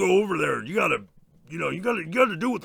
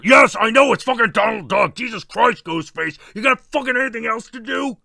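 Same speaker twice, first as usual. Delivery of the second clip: louder, fed-up yelling back; exasperated outburst; 5 semitones higher, 6 dB louder than usual. Retold or imitated. Slasher yelling at the pestering caller, exasperated. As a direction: snap back, sick of the caller.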